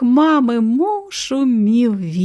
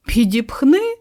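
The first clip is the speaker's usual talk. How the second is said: mildly indignant, higher questioning crow; indignant snort; 6 semitones higher, 4 dB louder than usual. A narrator's reading. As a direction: scoff it back as a raised question. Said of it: "пирхнув ворон".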